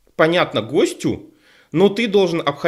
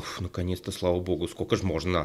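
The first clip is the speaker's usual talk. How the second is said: quiet, exhaled relief, much softer; relieved sigh; 7 semitones lower, 9 dB quieter than usual. Retold or imitated. Host recalling relief at dropping translation, quiet sighing relief. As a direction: let the words go out like a weight dropped. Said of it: "с таким облегчением и сказал".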